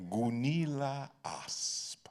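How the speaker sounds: slow theatrical name drop; savoured name. Acted. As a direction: relish the name, stretching every syllable.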